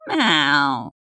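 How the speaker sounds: falling cat wail; irritated meow. A narrator's reading. Cat's protest comes out as an irritated meow. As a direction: meow in annoyance, drawn out. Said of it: "лишь раздражённое".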